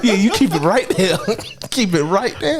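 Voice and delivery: talking through laughter; laughing. Real, not acted. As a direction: laugh while egging him on. Laughing, playing along.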